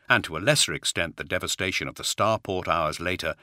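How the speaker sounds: ordinary delivery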